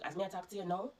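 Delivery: ordinary delivery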